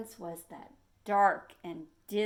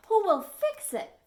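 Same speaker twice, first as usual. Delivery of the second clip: anxious call; 9 semitones higher, 7 dB louder than usual. Acted. Fish asking anxiously.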